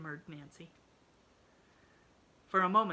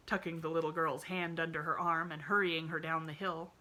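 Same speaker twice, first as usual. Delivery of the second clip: plain reading voice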